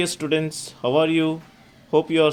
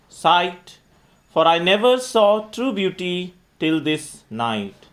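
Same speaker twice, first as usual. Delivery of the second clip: poem recitation; 4 semitones higher, 4 dB louder than usual. Acted. Teacher reciting Romeo's monologue for students, declamatory verse reading. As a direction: recite it loud and measured, phrase by phrase.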